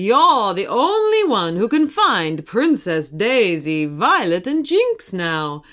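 encouraging cartoon hero, put-on voice; Mario. Mario puts Archie in control, hearty character voice. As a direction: coach him along in a lilting game-hero voice.

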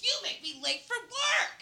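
high, drawn-out put-on complaint; imitating an aggrieved commuter; mock accusation. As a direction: whine the blame up high and slow. Voicing angry commuter.

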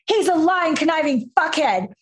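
high, heated name-calling; retold angry outburst. To her coach about her ex, mock furious rant. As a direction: spit out the insult in mock fury.

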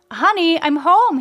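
high, cheerful call through the house; husband calls to his wife coming through the door, high and cheerful; shouted greeting. A narrator's reading. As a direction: call out to the wife as you walk in. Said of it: "he shouted to his wife".

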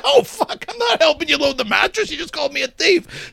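high-pitched mock outrage; retold indignant refusal. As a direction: imitate an offended man refusing.

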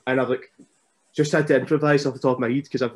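ordinary delivery

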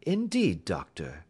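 airily polite, unruffled; bland politeness. Acted. Holmes answers the angry doctor, bland politeness. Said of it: "said Holmes blandly".

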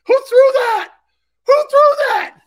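very high, loud outraged squawk; hammed-up high-pitched yelling; mock indignant shout. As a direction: yell it like a pelted, flustered coach. Acts coach hit by a donut.

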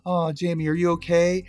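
high, sugary-mean retold put-down; mock concern. Lexi to upset Jamie, mock-concerned.

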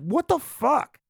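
high, slow, appalled what the fuck; outraged disbelief. Real, not acted. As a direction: drag it out in disbelief.

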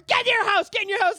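loud, high mock scolding; imitated yelling. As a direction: yell her back indoors, hammed up. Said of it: "they just start yelling at her".

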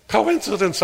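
Coincidence pitched high and scoffing; self-mocking. Preacher mocking his own excuse, Coincidence pitched high. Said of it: "I tell myself".

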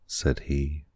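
ordinary delivery, nothing marked

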